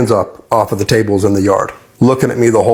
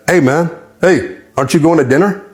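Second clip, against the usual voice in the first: cellmate calling out; 6 semitones higher than usual. Retold or imitated.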